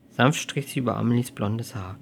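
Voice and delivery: usual speaking voice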